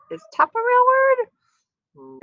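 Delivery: high, slow, wondering question; hammed-up curious question; mock wonder. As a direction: stretch it out, playfully puzzled.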